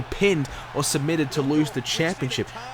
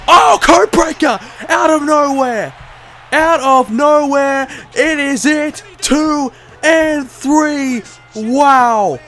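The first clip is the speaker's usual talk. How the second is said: screaming commentator, much higher and louder; excited shouting; 9 semitones higher, 8 dB louder, slower than usual. Acted. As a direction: lose it at the big moment. Big spot commentary.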